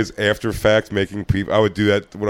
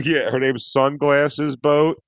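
slow mock naming; 3 semitones higher, much slower than usual. Joking slow fake names.

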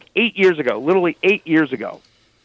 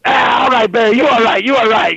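high, loud, laughing-ish approval; mock hearty reconciliation; 8 semitones higher, 4 dB louder than usual. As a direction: welcome him in with a big grin. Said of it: "Irv's like".

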